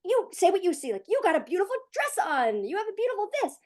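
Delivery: energized praise. Retold or imitated. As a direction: gush over your kid like a pepped-up cheerleader. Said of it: "very energized, energized way".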